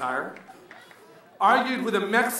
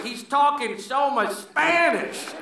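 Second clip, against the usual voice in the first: slow put-on gringo voice; mock the puzzled crowd, slowly; slow dumbfounded American voice; audience thought; 6 semitones higher, slower than usual. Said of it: "you're looking at me like".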